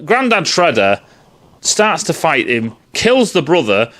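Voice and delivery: ordinary talk, nothing marked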